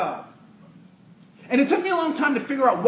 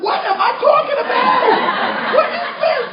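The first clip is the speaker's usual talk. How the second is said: laughing disbelief; 8 dB louder, slower than usual. Retold or imitated. Shocked at own words, laughing.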